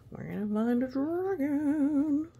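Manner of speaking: high, loud thrilled announcement; excited. Acted. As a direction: sell the adventure with glee. Excited call to child.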